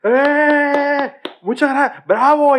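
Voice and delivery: high, cheering mock applause; imitating passengers clapping on landing; mocking plane clappers. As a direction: ham up the passengers cheering the pilot. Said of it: "¿qué le aplaudes?".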